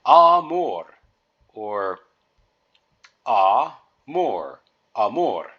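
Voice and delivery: amor, stressed on the mor; amor, open a and clear r; careful amor. Shown as a demonstration. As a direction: model amor slowly for learners. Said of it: "So the first word is".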